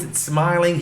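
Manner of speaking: ordinary talk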